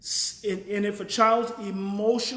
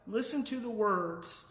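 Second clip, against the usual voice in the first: ordinary delivery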